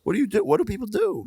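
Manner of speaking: exasperated. Shown as a demonstration. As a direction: ask it high and baffled. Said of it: "You guys hear me say it".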